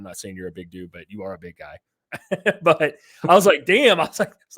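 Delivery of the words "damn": higher, louder stunned "Damn"; mock amazed. Recalling meeting his cousin's husband, higher and louder amazed. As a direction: replay the jaw-drop as he saw him.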